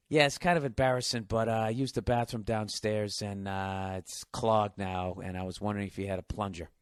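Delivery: low, soft, halting mumbled confession; sheepish kid. Retold or imitated. Party guest asking host for a plunger, sheepish. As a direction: mimic an embarrassed guest, sinking low and quiet.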